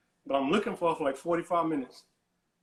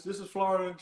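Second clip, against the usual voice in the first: normal talking voice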